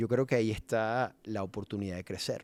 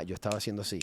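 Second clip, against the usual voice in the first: ordinary delivery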